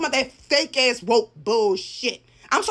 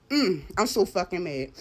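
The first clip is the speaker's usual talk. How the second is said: usual speaking voice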